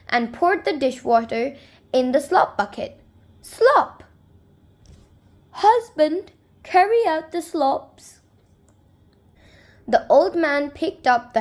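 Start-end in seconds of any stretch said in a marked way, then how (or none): 3.4-4.0 high, loud "Slop!" sound effect; splashy slop. A narrator's reading. Reading to kids, the bucket lands: a high, loud "Slop!". 5.5-8.2 a higher-voiced old wife calling out; calling husband. Old woman tells husband to take out slops, calling lightly.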